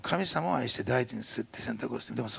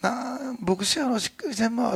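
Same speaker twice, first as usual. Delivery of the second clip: higher, cheerful Osaka mother quoting scripture; upbeat Osaka mom reminding her son of the Bible; mother in Osaka dialect; 9 semitones higher than usual. Retold or imitated. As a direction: lift him up with Kansai brightness. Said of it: "とか言ってね、全然明るいの".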